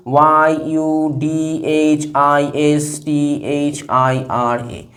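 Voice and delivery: slow, evenly spaced letters on a level tone; spelling out letters. Shown as a demonstration. Teacher writing Yudhishthira's name, spelling out letters.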